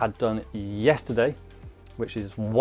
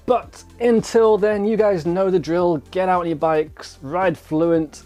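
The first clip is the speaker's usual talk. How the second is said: upbeat sign-off; 7 semitones higher, 5 dB louder, slower than usual. Real, not acted. Signature sign-off to viewers.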